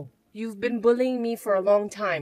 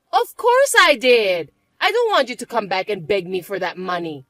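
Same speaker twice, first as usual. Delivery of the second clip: smug stepsister; 8 dB louder than usual. Acted. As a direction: savour every word.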